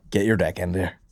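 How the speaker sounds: ordinary delivery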